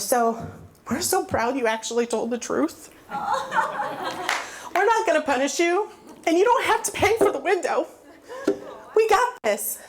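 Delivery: hammed-up proud mother forgiving her child; sweet mom. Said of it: "and she goes".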